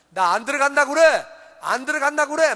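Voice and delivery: put-on older brother hollering in protest; acted angry shouting. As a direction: shout your refusal from outside the house. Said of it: "밖에서 소리 지릅니다".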